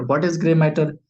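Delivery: usual speaking voice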